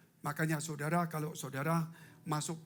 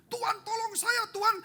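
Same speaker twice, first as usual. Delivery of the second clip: much higher, frantic cry for help; panicked plea; 5 dB louder than usual. Retold or imitated. Driver crying to God at the crash, high and frantic. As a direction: yelp for help before the crash. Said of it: "nggak mungkin langsung".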